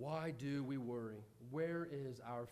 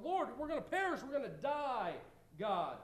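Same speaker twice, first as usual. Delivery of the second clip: mock panic; 8 semitones higher than usual. Disciples panicking.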